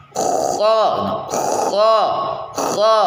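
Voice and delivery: kho dengan nafas mengalir tanpa tahanan; kho mengalir dari tenggorokan atas; pelafalan kho. Shown as a demonstration. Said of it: "biarkan nafas dan suara berlalu". (kho lepas tanpa tertahan)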